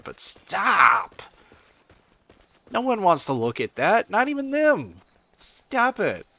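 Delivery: high, pleading stop; mock-despairing high whine; exasperated pleading. Ranting at hentai artists.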